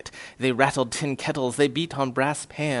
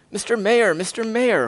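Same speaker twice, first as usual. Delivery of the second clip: calling out; 7 semitones higher, 4 dB louder than usual. Acted. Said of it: "Horton called".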